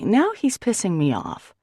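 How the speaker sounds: usual speaking voice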